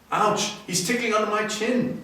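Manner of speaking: put-on a child squirming as fur tickles him; Teddy's giggly voice. Teddy as the mongoose climbs his shoulder, ticklish child. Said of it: "said Teddy".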